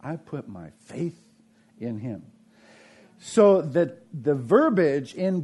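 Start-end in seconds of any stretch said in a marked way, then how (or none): 0.0-2.2 soft and low, earnest; sincere believer's pledge. Retold or imitated. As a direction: say it quietly and mean it. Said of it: "No, it's".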